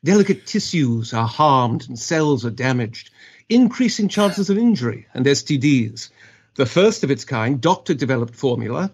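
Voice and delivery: British-accented commercial read; English accent. Shown as a demonstration. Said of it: "Just give us your best accent".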